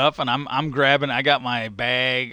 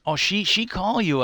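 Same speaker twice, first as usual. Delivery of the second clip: higher-pitched, lightly imitated Mexican English; imitated Mexican accent; 6 semitones higher than usual. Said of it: "he didn't speak a lot of English".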